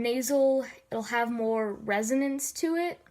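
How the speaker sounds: ordinary delivery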